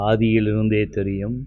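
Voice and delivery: usual speaking voice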